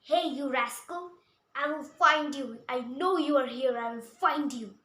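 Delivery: loud angry threat; a loud, angry yell at the rascal; very bad voice. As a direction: shout it at the hiding thieves, furious. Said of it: "said in a very bad voice".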